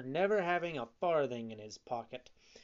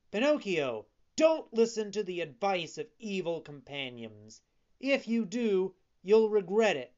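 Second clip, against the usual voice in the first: high, loud, urgent warning; warning blackbird; 5 semitones higher, 6 dB louder than usual. A narrator's reading. Blackbird warning Pinocchio of bad companions, urgent. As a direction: call out to save the puppet.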